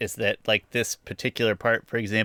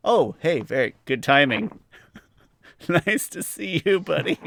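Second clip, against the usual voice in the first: mock friendly greeting; 6 semitones higher than usual. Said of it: "I'd be like".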